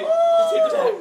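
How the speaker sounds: loud high urgent yell; shouting. Real, not acted. Urgent yelling at friend.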